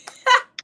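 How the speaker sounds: sudden bright laugh, way up high; a loud, high giggle at herself; high burst of laughter. Real, not acted. Laughs at own boast.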